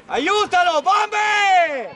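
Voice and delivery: drawn-out sideline yell; urgent shout. Real, not acted. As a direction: yell for a teammate to help. Coach urging player to help.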